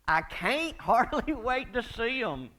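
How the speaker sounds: loud, high and slow, bursting with eagerness; gleeful anticipation. Real, not acted. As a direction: draw it out with excited relish.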